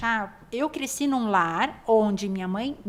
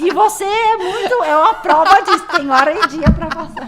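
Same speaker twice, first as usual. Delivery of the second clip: laughing; 7 semitones higher, 14 dB louder than usual. Real, not acted. Laughing at shared joke.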